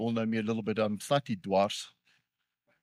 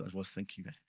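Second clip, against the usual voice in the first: sheepish quiet confession; 4 semitones lower, 12 dB quieter than usual. Real, not acted. Preacher owns up to the church, sheepishly soft. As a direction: drop much softer, like owning up. Confessing a near-swear to the congregation.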